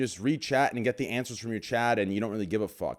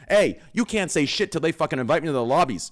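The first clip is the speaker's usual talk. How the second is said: loud, high mocking callout; taunting; 7 semitones higher, 6 dB louder than usual. Real, not acted. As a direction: jeer at the rivals who won't invite you.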